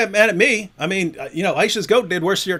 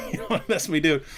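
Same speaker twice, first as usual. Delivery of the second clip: laughing threat; 4 semitones lower, 8 dB quieter, much faster than usual. Real, not acted.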